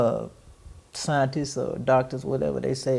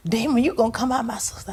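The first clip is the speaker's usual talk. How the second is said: higher mock command, dropping to a hush at the end; imitating brother's rebuke; 10 semitones higher, faster than usual. Brother shaking his sister, ordering the demon out in a high voice. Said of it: "he was shaking you".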